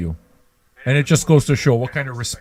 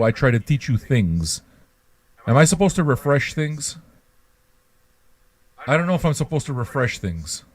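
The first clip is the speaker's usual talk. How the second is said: ordinary talk, nothing marked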